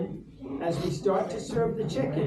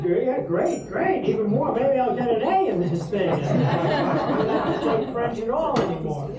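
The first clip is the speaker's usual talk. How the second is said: put-on higher, mildly excited young self; retold eagerness; 4 semitones higher, 4 dB louder than usual. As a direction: act out naive delight at the offer. Retold delighted reply to wine offer.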